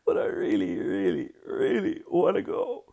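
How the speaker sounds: high, acted whimpering plea; tearful child pleading. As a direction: plead like an upset little boy. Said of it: "Big wet tears dripped down Hal's cheeks".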